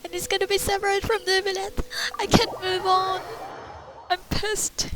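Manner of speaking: frustrated. Real, not acted. Streamer annoyed she cannot progress, frustrated rant. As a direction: vent at the game splitting the village. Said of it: "I'm frustrated".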